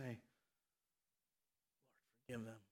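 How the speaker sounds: very low, barely audible grudging mumble; begrudging mutter. Acted. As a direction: mutter it reluctantly under your breath. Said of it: "He didn't say it begrudgingly".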